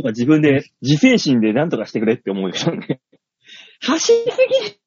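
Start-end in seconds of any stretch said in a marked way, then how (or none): 3.8-4.7 high laughing scold; high laughing too-fast; laughing complaint. Real, not acted. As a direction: squeal it through a laugh. Laughing complaint about partner.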